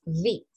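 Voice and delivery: "V" as a low "vee", buzzed at the lip. Shown as a demonstration. Teacher repeats English V, low F-like start. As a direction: buzz into "V" like a voiced f. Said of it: "como si hubiera una F".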